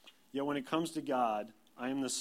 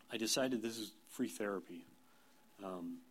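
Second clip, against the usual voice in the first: usual speaking voice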